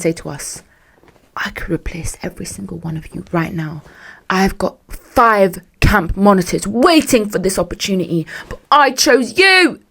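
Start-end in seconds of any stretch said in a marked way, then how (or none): 1.4-3.8 mock-menacing hush, softly delivered; hushed threat. Camp boss threatening exhausted staff at a meeting, in a menacing hush. 4.3-9.8 mock grandiose speech. Camp boss lecturing staff on their luck, building grandly.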